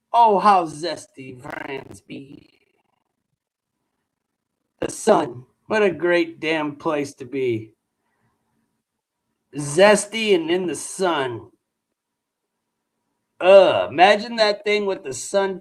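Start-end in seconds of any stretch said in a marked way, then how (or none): 0.1-11.5 hammed-up romantic praise, lower; mock ode. Streamer mocking France in a lower mock-poetic voice.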